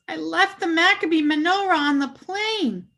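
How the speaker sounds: put-on understated distressed child; cried out. A narrator's reading. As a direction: blurt it out in dismay. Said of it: "she cried".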